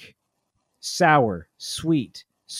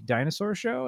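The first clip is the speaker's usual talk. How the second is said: put-on higher little-kid question; toddler asking; 9 semitones higher than usual. Son asking dad for his show, high kid voice. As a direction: ask hopefully like a toddler. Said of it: "And he'll be like".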